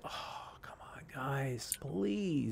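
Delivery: breathy whisper, drawn-out and pleading; hushed, weary plea to the developers; exasperated whisper. Retold or imitated. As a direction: whisper it like you're begging under your breath. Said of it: "I'm just like".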